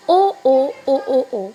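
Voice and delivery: lightly vary the tune on every "ओ"; repeated "ओ", starting firm and easing off; varied inflection. A narrator's reading. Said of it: "giving it a different inflection each time".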